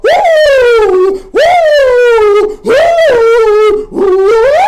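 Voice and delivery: very high, very loud sustained woos. Shown as a demonstration. Host showing viewers how to woo, full volume. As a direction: let each woo ring out full force. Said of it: "open up your mouth and go".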